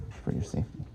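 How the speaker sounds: hushed whispered echo of the line; ghostly whispered repeat. Acted. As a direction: breathe it out like a creepy secret.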